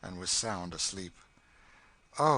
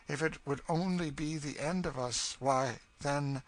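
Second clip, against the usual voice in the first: higher character voice, bitter argument; brooding, bitter woman; 7 semitones higher, 3 dB louder than usual. Acted. Cassie arguing over death with the girl, brooding bitterness. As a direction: argue darkly, then falter into longing.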